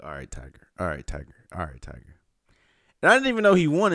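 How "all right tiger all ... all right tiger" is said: quiet. Retold or imitated. Glued to TV, muttering.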